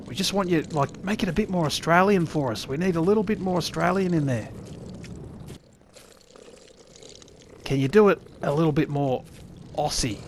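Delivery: a hint of a bossy American producer; mock game producer. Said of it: "by someone who is Australian and the creators of the said game".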